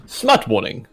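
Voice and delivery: normal talking voice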